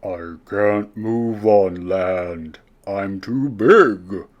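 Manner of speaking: beached whale moaning to the snail. A narrator's reading. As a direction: moan it helplessly like a stranded giant. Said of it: "moaned the whale".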